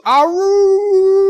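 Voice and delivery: high, loud howl; wolf howl. Acted. Greeting the dog Sassy.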